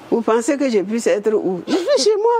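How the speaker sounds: squeaky high indignant answer; indignant retort. Retold or imitated. Woman retelling her reply to the caller, high and indignant. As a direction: shoot back, astonished at the question.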